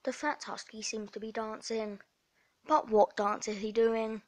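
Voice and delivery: ordinary talk, nothing marked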